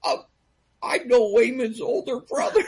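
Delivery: high, excited lightbulb moment; sudden realization. Retold or imitated. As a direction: act it dawning on you, breaking into laughter. Acts out his sudden realization.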